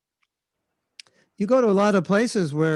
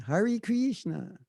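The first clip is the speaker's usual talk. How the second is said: higher, softer, drawn-out American chant; New York American accent; 5 semitones higher, 5 dB quieter, much slower than usual. Retold or imitated. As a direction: stretch it like an early New York devotee. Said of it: "it's very New York, very American".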